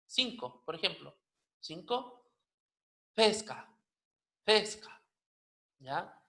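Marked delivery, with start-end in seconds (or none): 3.1-5.0 "pesca" with a breathy puff after the P; aspirated p-h opening "pesca". Shown as a demonstration. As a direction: release the P of "pesca" with a burst of air. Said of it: "la P H aspirada".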